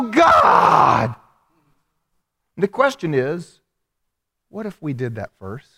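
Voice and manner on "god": loud, high drawn-out shout; desperate cry. Acted. Sick of the cycle, crying out to God, desperate shout. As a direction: cry out at the end of your rope. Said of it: "that you'll go".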